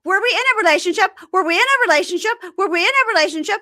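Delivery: high, loud, fast mock shrieking; hammed-up hysterical demand. Imitating the girlfriend demanding answers from Zied, high fast shriek. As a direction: shriek the question over and over. Repeating her hysterical question.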